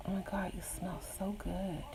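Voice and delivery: breathy seductive whisper; sexual voice. Shown as a demonstration. Leaning in to whisper to a man she likes, seductive whisper. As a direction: breathe it like a come-on. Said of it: "in a sexual voice".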